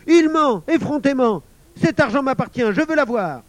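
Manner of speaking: put-on very high, loud indignant boy; high, outraged cry; furious outburst. A narrator's reading. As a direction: shout down the liar and demand what's yours. Émile furiously demands his money back.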